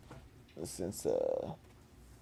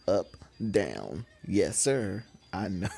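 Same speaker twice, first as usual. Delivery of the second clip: ordinary delivery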